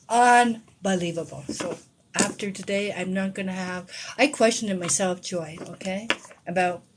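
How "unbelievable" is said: ordinary talk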